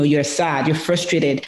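usual speaking voice

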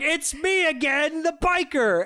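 high, slow villain announcement; biker impression, hammed-up. Biker reappearing late in the movie, hammed-up announcement. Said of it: "And he's like".

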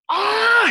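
high, loud startled scream; a sudden pained shout of surprise; retold scream. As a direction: yelp as if struck hard. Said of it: "he screamed".

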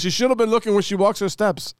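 high put-on voice quoting the landlord; mock landlord. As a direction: imitate the dismissive landlord. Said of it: "He goes".

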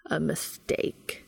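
"a mistake" slow and low after a pause; dramatic delivery. A narrator's reading. Castiel retaliating in a pillow fight, "a mistake" slow and dramatic. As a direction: land "a mistake" slowly, dropping low. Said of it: "paused for dramatic effect".

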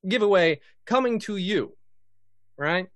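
ordinary delivery

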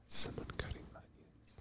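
hushed whisper to self. Real, not acted. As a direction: whisper it under your breath. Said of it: "repeats a quote in a whisper".

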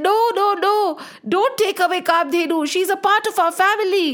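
high-pitched, insistent refusal; worked-up storybook protest; urgent protest. A narrator's reading. Sage protesting the cow's seizure.

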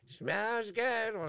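put-on high, thin old woman's voice; imitating the homeless woman savouring the smell; imitated old woman.

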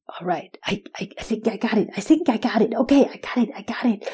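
soft, whispered thinking to himself; quiet thinking aloud. Acted. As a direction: murmur it to yourself.

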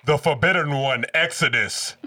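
loud card summon call; dramatic announcing. Pretend. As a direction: call it out like a duelist.

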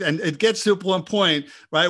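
ordinary delivery

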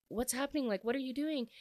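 high, alarmed retold questions; mock panicked friends chasing Hudson. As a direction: pitch it up like worried friends calling after him. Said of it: "so they're freaking out".

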